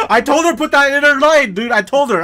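loud, high, laughing boast; gleeful bragging. Real, not acted. Fan jokingly claiming credit for a lyric, gleeful. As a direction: crow about it through laughter.

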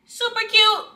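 high delighted squeal; excited. Real, not acted. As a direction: gush over it, way up high. Gushing over the scrunchie.

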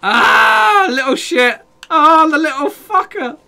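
very high, loud screamed swearing; real high-pitched yell, stretched "Ah"; screamed cursing. Real, not acted. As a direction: scream curses at the attacker. Fighting off an attacking animal.

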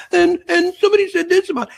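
high-pitched mock whine; whining. Mimicking a complainer going to the internet, mock whine. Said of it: "he even had to whine".

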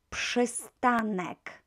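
przystanek said slowly, syllable by syllable, psh at the start; slow Polish pronunciation. Shown as a demonstration. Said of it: "harder word".

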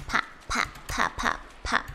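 short unvoiced mouth pops; playful string of little pop sounds; popping noises. Imitating a noise in play.

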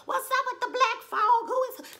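loud, high-pitched mock viewer outcry; exasperated outburst. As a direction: belt it out high, like a fed-up viewer. Mimicking frustrated viewers' reaction.

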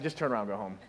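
low, soft voice of God; God's voice. Retold or imitated. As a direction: drop down low and quiet as God. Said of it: "and God said".

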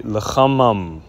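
'lachamam' with throaty ch and final -am; Hebrew masculine form. Shown as a demonstration. Teacher repeats masculine example for learners, 'lachamam' closing on m. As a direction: say 'lachamam' evenly, ending on m. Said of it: "You would say".

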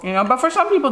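ordinary talk

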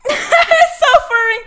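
drawn-out high laughing moan; mock anguish through laughter; mock suffering. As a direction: stretch it out, cracking up. Mocking friend's suffering.

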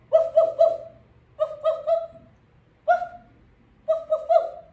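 loud, high yappy woofs; dog bark. Shown as a demonstration. Instructor answering what the dog says, loud high woofs. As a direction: bark in short high bursts like a small dog. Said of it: "what does the dog say".

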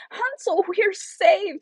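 high-pitched cry of relief; joyful cry. A narrator's reading. Gretel freeing Hansel from the stable, joyful cry. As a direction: cry out the good news, high and urgent. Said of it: "and cried".

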